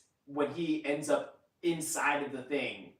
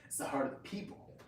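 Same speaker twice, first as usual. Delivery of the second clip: whispered joke; 9 dB quieter than usual. Real, not acted. Joking whisper.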